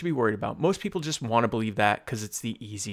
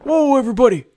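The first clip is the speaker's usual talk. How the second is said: Kramer-style entrance; 14 semitones higher, 11 dB louder, faster than usual. Retold or imitated. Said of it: "He comes in".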